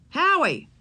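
mother calling her son's name. Acted. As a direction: call the boy over. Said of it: "Missus Kemp called out".

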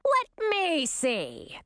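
slow, high crowing queen; gloating mother in a put-on high voice. A narrator's reading. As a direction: crow it, drawn out and high. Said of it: "crowed Olivine".

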